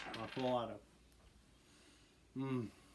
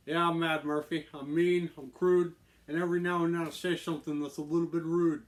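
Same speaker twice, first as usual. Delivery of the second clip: loud, rhyming Irish gangster boast; attempted Irish mob boss; 5 semitones higher, 8 dB louder than usual. Host repeating his character's rhyme, loud Irish mob boss. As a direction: announce yourself as a brash Irish mobster. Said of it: "like, a, an Irish mob boss".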